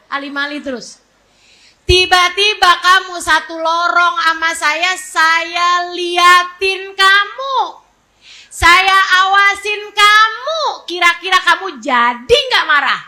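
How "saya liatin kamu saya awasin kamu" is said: slow, stretched, rising emphasis; drawn-out warning. Pretend. Preacher warning a parent she is watching, slow and stretched. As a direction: draw each word out, swelling at the end.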